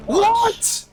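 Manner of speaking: high startled outburst; shocked. Real, not acted. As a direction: gasp it out in disbelief. Shocked by early access news.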